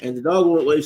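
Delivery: ordinary talk, nothing marked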